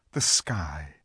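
plain reading voice

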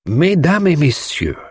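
high, slow, grand French greeting; Poirot, lecturer's address. A narrator's reading. Poirot opens proceedings before the assembled household, grandly. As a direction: address them like a celebrity lecturer. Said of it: "as though he were a celebrity about to deliver a lecture".

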